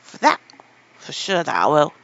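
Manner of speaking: loud, stout Yorkshire assurance. Acted. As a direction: insist firmly and loudly. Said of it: "he said stoutly".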